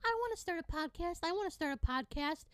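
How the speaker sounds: sing-song mock of trend followers; mocking wannabe podcaster. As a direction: parrot them like an eager copycat. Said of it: "or like".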